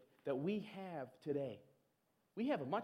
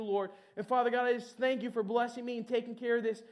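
confident prayer; 4 semitones higher, 3 dB louder than usual. Pretend. Bold confident prayer example.

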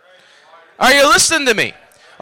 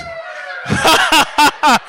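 high laugh tailing away; high laughter; 14 semitones higher than usual. Real, not acted. Preacher laughing at the devil, high burst.

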